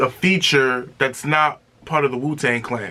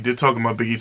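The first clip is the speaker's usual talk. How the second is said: ordinary talk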